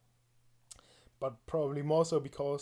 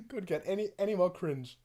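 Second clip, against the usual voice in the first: ordinary delivery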